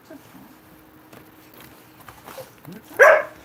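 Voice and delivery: high-pitched coaxing pet talk. Real, not acted. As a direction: coo to the dog in a high, soft voice. Talking to his dogs.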